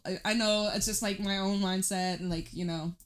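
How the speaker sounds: ordinary delivery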